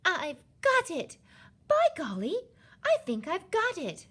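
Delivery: high, loud, thrilled exclamation; bursting, high-pitched eureka; filled with excitement. A narrator's reading. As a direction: cry out a sudden brainwave. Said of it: "in a voice filled with excitement".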